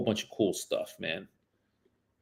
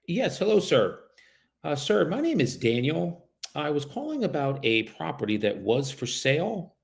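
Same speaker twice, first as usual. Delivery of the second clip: raised, polite cold-call voice; phone sales voice; 4 semitones higher than usual. Acted. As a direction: sound friendly and professional on the phone.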